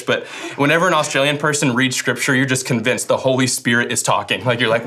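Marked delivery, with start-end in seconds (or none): none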